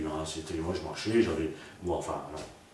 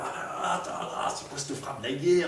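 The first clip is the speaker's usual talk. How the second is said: mock self-mockery; 7 semitones higher than usual. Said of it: "Donc il se chambre aussi".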